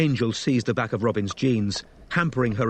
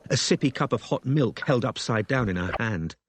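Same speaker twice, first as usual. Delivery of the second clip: ordinary delivery, nothing marked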